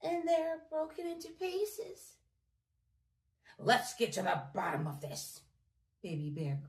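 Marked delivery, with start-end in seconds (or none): 0.0-2.2 high little cub voice; tiny Baby Bear voice. A narrator's reading. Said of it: "he cried". 3.6-5.4 low, loud bear growl; gruff daddy bear, lower and louder. A narrator's reading. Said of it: "Daddy Bear growled".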